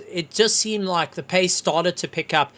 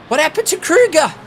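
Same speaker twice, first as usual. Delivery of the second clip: higher, louder, fast disbelief; alarmed question; 10 semitones higher, 7 dB louder than usual. Real, not acted. Commentator reacting to Kruger vanishing on air, alarmed. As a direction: demand to know what went wrong. Commentator sees driver drop out.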